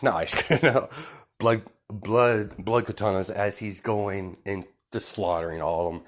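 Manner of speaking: ordinary delivery, nothing marked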